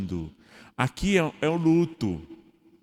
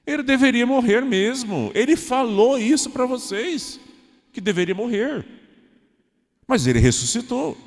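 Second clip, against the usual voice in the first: much higher, louder declaring; fervent proclamation; 12 semitones higher, 5 dB louder than usual. Pretend. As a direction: proclaim he had to die and rose. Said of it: "Aí Jesus diz assim". (proclamação fervorosa, alta e aguda)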